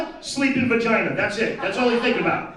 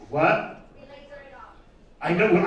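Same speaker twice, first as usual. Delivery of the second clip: normal talking voice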